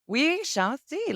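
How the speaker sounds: playful. Acted. Playful reply to colleague.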